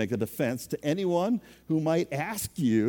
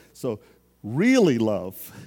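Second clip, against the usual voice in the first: usual speaking voice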